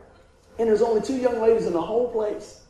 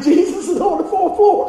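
higher and louder, laughing with glee; excited laughing thought; 10 semitones higher, 8 dB louder than usual. Retold or imitated. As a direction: burst out with delight.

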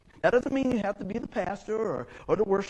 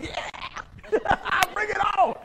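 excited cheering; 12 semitones higher, much slower than usual. Retold or imitated. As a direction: cheer them on with a laugh, very excited. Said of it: "I get just a little bit excited".

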